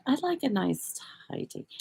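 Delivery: amateur British accent impression; mock British accent. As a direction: imitate a British viewer, quietly. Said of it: "hear him in his little accent".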